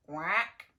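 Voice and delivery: loud mock duck quack. Story duck sound.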